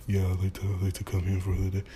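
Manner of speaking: put-on teen mumbling at the counter, barely audible. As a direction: mumble it like a shy teenager. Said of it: "Speak up, man".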